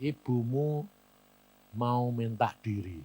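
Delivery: softly voiced words of God; suara Tuhan. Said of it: "Tuhan sih ngomong". (suara Tuhan yang lirih)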